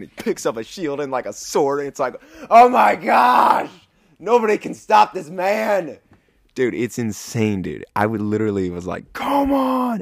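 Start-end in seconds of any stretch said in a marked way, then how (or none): 2.5-6.0 very high, slow shout of amazement; hyped-up awe. Retold or imitated. Fan reacting to Din's rampage, shrieking high awe. 9.1-10.0 cheering shout. Real, not acted. Cheering at the screen.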